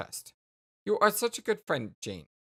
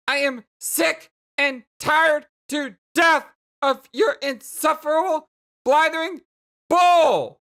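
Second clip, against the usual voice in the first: high, loud tirade building to the end; angry shouting; 9 semitones higher, 8 dB louder than usual. Acted. Jane fed up with Jake's rambling, furious shouting. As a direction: hammer out each word in fury. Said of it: "makes it seem like you're shouting".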